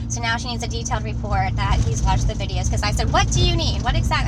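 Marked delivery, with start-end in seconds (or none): 3.1-4.3 high, loud, exasperated demand; sharp demand. Retold or imitated. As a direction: blurt it out as a loud, pointed demand. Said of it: "I didn't say it like that".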